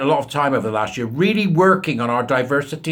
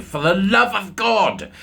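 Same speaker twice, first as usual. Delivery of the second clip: exasperated; 7 semitones higher, 4 dB louder, slower than usual. Real, not acted. Exasperated outburst.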